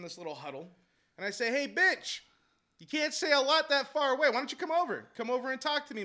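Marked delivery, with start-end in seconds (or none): 1.5-6.0 loud, high challenge; retold calling out. Coach calling across the parking lot, loud taunting challenge. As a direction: shout him over as if from far off.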